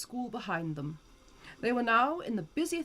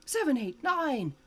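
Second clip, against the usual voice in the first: usual speaking voice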